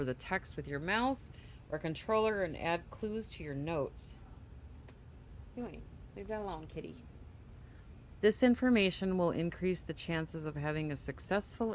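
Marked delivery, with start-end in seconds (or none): 5.6-7.0 fast, soft aside to the cat; quiet aside. Real, not acted. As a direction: mutter it quickly under your breath.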